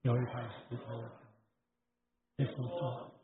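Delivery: quiet group recitation of scripture, far off; congregational scripture reading. Acted. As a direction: read the Bible aloud together softly. 会众一起朗读经文. (会众一同轻声朗读经文)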